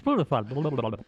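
Plutophonics rattled off, collapsing into blah-blah babble; fast tongue twister. Shown as a demonstration. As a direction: race through Plutophonics and give up in nonsense. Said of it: "Say it five times fast".

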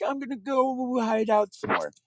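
put-on high little child's voice; small scared kid. Frightened boy slipping away, high and small.

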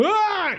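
high, strained cry at normal volume; mock battle scream. Game master imitating a fighter in the melee, mock scream.

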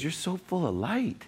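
high, soft imitation of the doctor; imitated doctor. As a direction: lift and soften the voice to mimic her. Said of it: "She goes".

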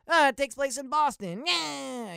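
loud, high mocking Boston accent. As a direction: ham up a cartoon Boston accent to mock lazy films. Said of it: "it's a Boston movie".

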